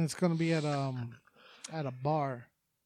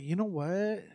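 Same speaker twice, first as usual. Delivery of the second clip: lightly put-on woman's voice; a hint of mimicking her; imitating how she talks; 3 semitones higher, slower than usual. Host mimics the woman starting her story, lightly put on. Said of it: "talking like that's how she talks".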